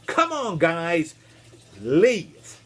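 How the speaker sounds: acted shooing-out call. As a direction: call the animals out of the boat. Noah calls animals out of ark.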